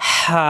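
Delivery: long airy হা from mid-throat; throaty ha. Shown as a demonstration. Teacher repeats for students, হা with throat pressure and air. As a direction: push hot air through a pressed throat on হা. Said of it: "গরম বাতাসের সাহায্যে".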